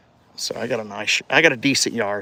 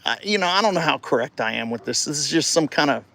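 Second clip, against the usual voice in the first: normal talking voice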